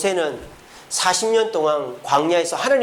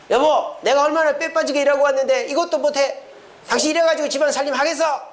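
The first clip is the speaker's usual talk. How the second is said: high-pitched mock marital tirade; angry berating; 8 semitones higher than usual. Husband complaining to wife about housework, angry berating. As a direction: nag hard in a raised, put-on voice.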